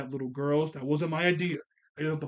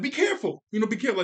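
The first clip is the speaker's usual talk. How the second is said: mock worried; 6 semitones higher, 4 dB louder, much faster than usual. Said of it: "she was like".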